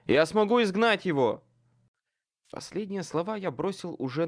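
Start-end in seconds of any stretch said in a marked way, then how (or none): 0.1-1.4 loud urgent shout. A narrator's reading. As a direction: yell it while running. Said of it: "крикнул я".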